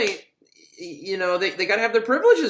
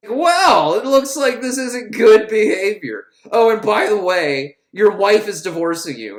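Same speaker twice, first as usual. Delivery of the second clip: louder, higher gloating mock guard; 5 semitones higher, 7 dB louder than usual. Guard Muncie taunting an inmate about divorce, loud gloating. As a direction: rub it in like a smug bully.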